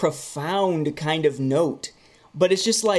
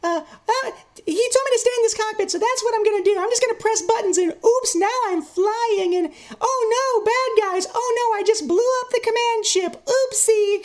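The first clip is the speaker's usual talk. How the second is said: high, fast, goofy kid voice mocking young Anakin. Reviewer mocks Phantom Menace Anakin in a high goofy kid voice. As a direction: play the bumbling boy pilot, squeaky and eager. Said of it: "shades of Anakin in Phantom Menace".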